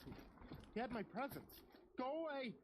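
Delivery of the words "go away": frightened mother screaming. Acted. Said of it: "she screamed. Her voice was shaking".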